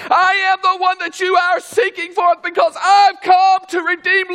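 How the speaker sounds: loud, high, ringing sermon voice with shouted peaks; preaching at full force. Acted. Preacher shouting to the congregation, fiery full-force preaching. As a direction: drive it home like a revival preacher.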